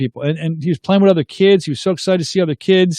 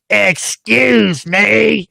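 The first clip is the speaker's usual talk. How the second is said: very drawn-out, higher put-on voice; yelling woman; 4 semitones higher, much slower than usual. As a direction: mimic an outraged stranger, stretching each word. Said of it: "like, yelling at me".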